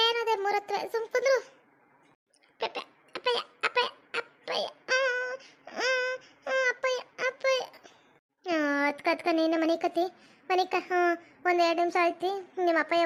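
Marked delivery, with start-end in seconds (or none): 2.6-7.6 put-on soft child voice whimpering for dad. Small child softly whimpering for its father at night. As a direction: whimper for daddy, close to tears.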